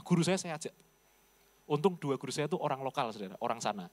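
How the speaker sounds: normal talking voice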